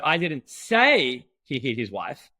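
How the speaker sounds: "say" stretched out and punched; drawn-out, emphatic "say", then the rest; stress on SAY. Shown as a demonstration. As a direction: lean long and hard on the word "say". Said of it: "but I didn't say it".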